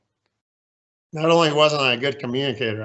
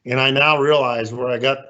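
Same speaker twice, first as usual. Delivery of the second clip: normal talking voice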